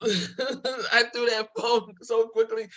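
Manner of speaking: talking through laughter, a little slow; can't hold back the giggles; laughing. Real, not acted. Laughing while retelling the call.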